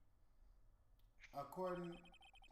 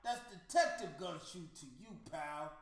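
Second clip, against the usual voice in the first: tough detective; 4 semitones higher, 9 dB louder than usual. Acted. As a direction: puff up like an offended cop. Voicing detective Gumshoe.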